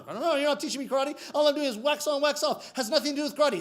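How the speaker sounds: high-pitched frustrated protest; complaining student. Acted. Student complaining to his sensei, high frustrated protest. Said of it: "he complains to Sensei".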